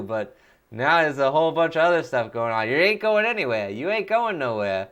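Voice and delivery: hammed-up cartoon villain rant; Voice of Nick. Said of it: "Voice of Nick voice".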